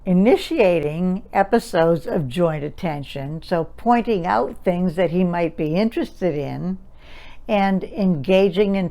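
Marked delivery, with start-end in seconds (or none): none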